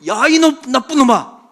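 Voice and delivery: high, very loud name-calling; mock angry shout. Son echoing his bully's words, mock angry shout. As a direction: imitate a kid hurling the insult straight back. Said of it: "똑같이요".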